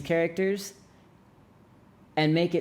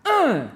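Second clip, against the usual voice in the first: loud high "uh" dropping sharply; hard falling grunt of anger; angry tone; 8 dB louder than usual. Shown as a demonstration. Teacher demonstrating the fourth tone, angry grunt. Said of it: "angry tone, fourth tone".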